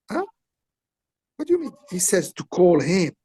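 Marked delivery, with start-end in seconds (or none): 0.1-1.7 imitating a startled Jean-Claude, pitched high; high doubtful Jean-Claude. Retelling how Jean-Claude balked, in a high startled voice. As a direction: squeak out his puzzled question.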